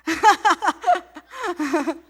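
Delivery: bursting into a laugh; real laugh. Real, not acted. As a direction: laugh at yourself. Laughing at own story.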